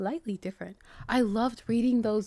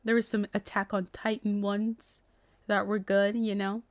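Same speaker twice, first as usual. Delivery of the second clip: ordinary delivery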